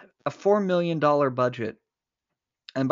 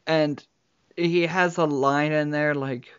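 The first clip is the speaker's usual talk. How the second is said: ordinary talk, nothing marked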